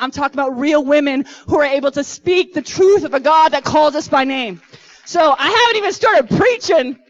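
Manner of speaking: rally the women, full force; Holy Spirit fire preaching to the women; fiery preaching. Acted.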